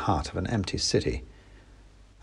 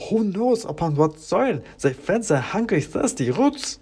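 clacking wooden soldier; 9 semitones higher than usual. Acted. Klaus warns the narrator not to eat, clacking. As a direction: warn in a stiff wooden clack. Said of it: "said Klaus".

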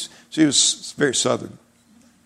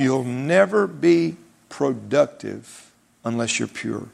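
NEVER stretched out, every word slowed right down; slow emphatic warning; much slower than usual. Real, not acted. Preacher slowly pressing his congregation on purity. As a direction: let "never" hang, then drop to a hush on "pure". Preacher drives home key line.

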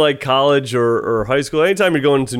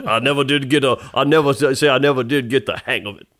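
put-on Cajun accent. Playing the Cajun waiter replying.

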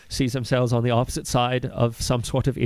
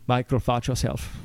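usual speaking voice

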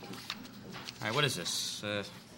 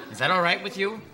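higher, louder, with a laugh; sarcastic challenge; 5 semitones higher, 4 dB louder than usual. Acted. Interviewer needling the applicant about Pepperdine, sarcastic. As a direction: throw it back at him mockingly.

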